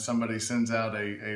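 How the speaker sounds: ordinary talk, nothing marked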